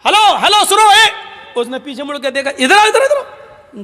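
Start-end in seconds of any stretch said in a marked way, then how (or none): 0.0-1.3 high calls after someone walking off; calling out. Acted. Umar calling back the departing young man, high calling out. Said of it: "اس کو آواز دی". 2.5-3.8 high, laughing call; calling over. Acted. Umar waving the young man back over, laughing call.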